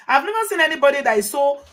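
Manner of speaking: ordinary talk, nothing marked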